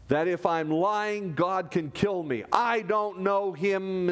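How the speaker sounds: ordinary delivery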